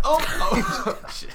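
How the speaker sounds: high, slow startled gasp into a laugh. Real, not acted. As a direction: react as if something just fell over. Bumped the table.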